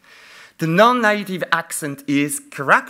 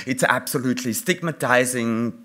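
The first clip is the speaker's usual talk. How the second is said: normal talking voice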